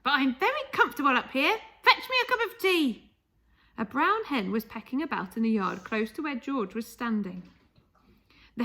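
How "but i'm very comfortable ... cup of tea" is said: put-on high, loud, cranky old grandmother; a bossy grandma talking down from the roof; Grandma's voice. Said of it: "she answered".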